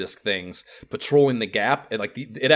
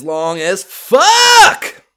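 exasperated yell; 11 semitones higher, 14 dB louder, much slower than usual. Real, not acted. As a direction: build into a long, loud shouted curse. Exasperated yell about the airship.

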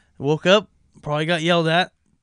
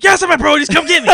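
put-on much higher, louder, strained kid voice; panicked squeak; 12 semitones higher, 10 dB louder than usual. Hungover kid begging mom for pickup, high panicked squeal.

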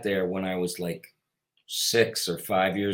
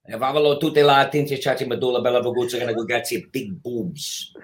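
fast Italian-sounding gibberish double talk; fake Italian; 3 semitones higher, 4 dB louder than usual. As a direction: rattle off fake Italian, hammed-up. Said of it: "Fake, fake Italian".

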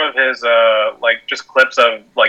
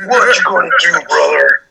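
a hint of Hulk Hogan; Hulk Hogan catchphrase; 3 semitones higher than usual. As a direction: wrestler catchphrase, lightly growled. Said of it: "Hulk Hogan has joined our podcast".

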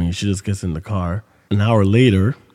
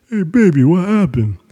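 put-on high, loud sweet-talking boyfriend; 10 semitones higher, 7 dB louder than usual. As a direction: coax her like a smitten boyfriend. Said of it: "like a boyfriend now".

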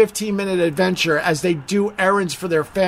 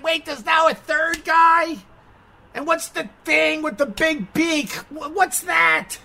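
higher, slow, befuddled voice; confused dad; 8 semitones higher than usual. Retold or imitated. Dad baffled by Moon Knight beside his son, befuddled whine. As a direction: play the lost dad watching with his son. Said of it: "and I'm like".

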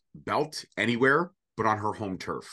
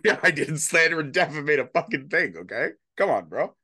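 louder, higher laughing denial; laughing exasperation; 5 semitones higher, 4 dB louder than usual. Real, not acted. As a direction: laugh it out, fed up.

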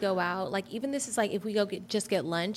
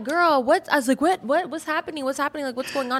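panicked; 6 semitones higher, 3 dB louder than usual. Retold or imitated. Panicked reaction to mom's call.

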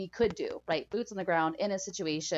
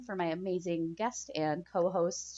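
normal talking voice

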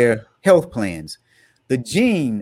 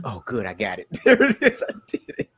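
laughing after finishing the line; relieved, laughing it out; laughing relief; 3 semitones lower, 3 dB quieter than usual. Real, not acted. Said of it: "get this out without laughing".

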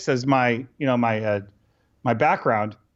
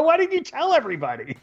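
incredulous; 11 semitones higher than usual. Retold or imitated. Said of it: "I go".

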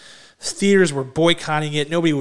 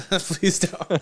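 pleading "Please don't" through a laugh, a bit higher; 4 semitones higher than usual. Real, not acted. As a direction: giggle while begging them to stop. Begging again through laughter.

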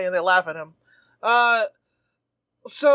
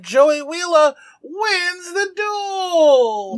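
higher, drawn-out triumphant cry; announcer victory call; 8 semitones higher, much slower than usual. Pretend. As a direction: stretch it out like a ring announcer. Triumphant call of the duel's winner.

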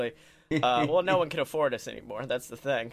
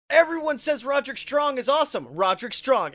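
high, far mocking fan voice; parroting what everyone says; mocking hype voice; 6 semitones higher than usual. Imitating hype of others.

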